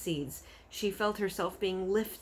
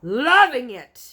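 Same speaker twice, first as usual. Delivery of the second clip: slow, much louder furious yell; 7 semitones higher, 16 dB louder, slower than usual. Acted. Bloodbottler yelling at the BFG. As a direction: throw the words back with fury. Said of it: "yelled the blood bottler".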